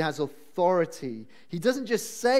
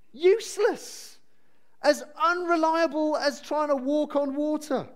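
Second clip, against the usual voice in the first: scornful; 8 semitones higher than usual. Real, not acted. Mocking verdict on Reuben.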